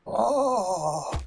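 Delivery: high, drawn-out creepy oh; put-on high-pitched creep; Herbert the Pervert. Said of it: "raspy thing to the high-pitched voice".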